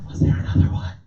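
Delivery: breathless hushed whisper. Retold or imitated. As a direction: whisper scared in the dark.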